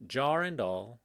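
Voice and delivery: usual speaking voice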